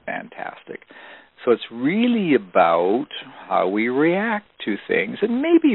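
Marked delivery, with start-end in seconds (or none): 0.1-0.9 fantastic in a soft whisper; hushed, voiceless fantastic. Shown as a demonstration. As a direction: breathe the punchline out. Punchline answer to audience question.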